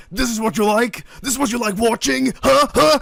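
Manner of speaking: high, angry mock scream; high-pitched hectoring rant, imitating a furious father; imitated screaming father. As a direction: berate him like an outraged dad. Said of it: "screams at him and goes".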